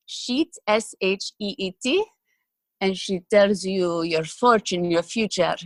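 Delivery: fortune teller in a Middle Eastern accent. Shown as a demonstration. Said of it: "sort of like this".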